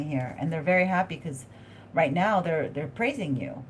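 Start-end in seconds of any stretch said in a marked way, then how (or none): none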